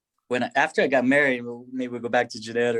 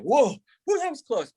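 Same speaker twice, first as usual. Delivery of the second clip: relieved; 12 semitones higher than usual. Retold or imitated. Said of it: "we're like".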